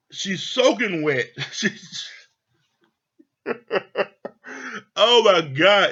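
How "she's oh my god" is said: laughing. Real, not acted. Laughing at the messy wife.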